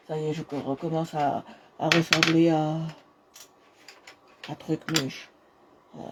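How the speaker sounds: cracking, dropping voice. Real, not acted. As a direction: voice breaking and fading. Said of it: "j'ai la voix qui déraille". (voix qui déraille)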